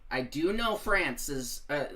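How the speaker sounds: ordinary delivery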